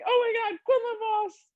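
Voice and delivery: high, excited fangirl squeal; starstruck squeal. Retold or imitated. Fan spotting a podcast host at a convention, squealing high. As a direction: squeal his name like you just spotted a celebrity.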